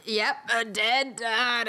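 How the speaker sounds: slow, drawn-out reveal; mock dramatic reveal. Dramatic reveal, echoing the listener.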